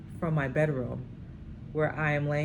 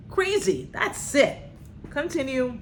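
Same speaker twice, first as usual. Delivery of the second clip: excited reaction; 10 semitones higher, 4 dB louder than usual. Real, not acted. Host reacts then resumes reading.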